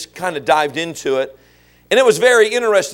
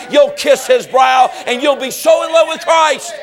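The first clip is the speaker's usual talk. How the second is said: full-force preaching; 6 semitones higher than usual. Acted. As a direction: hammer it home from the pulpit.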